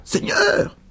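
high breathless exclamation; exclaiming. A narrator's reading. Old woman spinning round, high breathless exclamation. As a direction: gasp it out in mock shock. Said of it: "elle s'écria".

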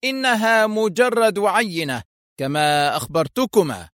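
high, smug announcement; lightly voiced villain gloating. A narrator's reading. Foster gloating after the warning volley. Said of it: "يرتفع صوت فوستر قائلا".

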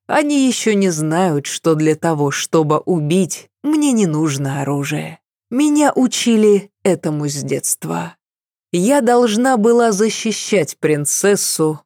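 plain reading voice

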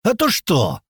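high-pitched, challenging question; defiant challenge. Acted. Cocky lad answering a warning, defiant. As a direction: dare him to follow through. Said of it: "с вызовом ответил мне этот".

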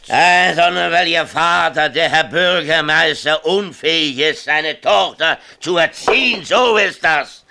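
loud, bitter self-accusation; bitter outburst. Acted. Father loudly blaming himself in front of the teacher, bitter.

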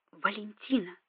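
soft, hushed whisper. A narrator's reading. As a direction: keep the name under your breath. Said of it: "прошептал Эндема".

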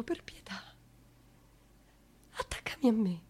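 breathless whisper begging for mercy; whispered plea. Acted. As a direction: whisper it, spent. (supplica sussurrata, senza voce)

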